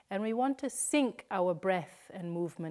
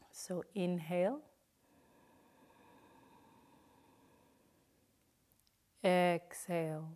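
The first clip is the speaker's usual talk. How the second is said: guided breathing cues. Shown as a demonstration. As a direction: lead the breath gently, leaving room to breathe. Counts breaths for the class.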